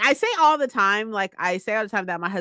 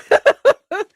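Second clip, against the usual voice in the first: flustered squeal; 12 semitones higher, 9 dB louder than usual. Real, not acted. Flustered reaction to praise.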